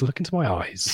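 Paul McKenna hypnotist. Retold or imitated. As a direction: soothe them into a trance, softly. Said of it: "Paul McKenna".

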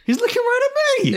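very high, quick mimicked cry; mock taken aback. Re-enacting the caller for co-host, taken aback. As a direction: squeal it like a stunned caller, amused. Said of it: "he's so taken back".

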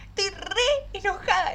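high, teary confession; retelling a sob to the therapist, high and teary; tearful high voice. Retold or imitated. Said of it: "le digo".

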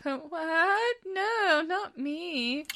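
high-pitched, feigned surprise; mock innocent denial. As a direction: deny it in a small high voice. Acts Dorian's guilty denial.

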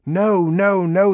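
loud, high raging howl; furious dog's cry. A narrator's reading. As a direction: bellow the refusal in a rage. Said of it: "the dog raged".

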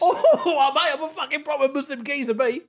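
loud, high hammed-up cockney geezer; mock cockney accent. Co-host joining in the workmate impression, hammed-up cockney accent. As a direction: ham up a cockney accent through laughter. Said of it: "He was like a cockney".

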